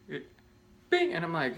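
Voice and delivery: ordinary talk, nothing marked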